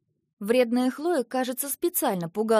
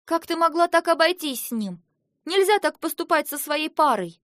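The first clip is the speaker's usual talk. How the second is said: loud, higher reproach; indignant scolding; 6 semitones higher, 6 dB louder than usual. A narrator's reading. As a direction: scold her hard for it. Dragoness scolds heroine. (громкий упрёк)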